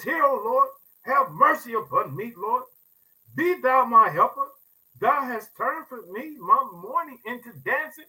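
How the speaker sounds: preacher pleading the psalm aloud; impassioned scripture reading. Acted. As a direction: cry the verse out to God.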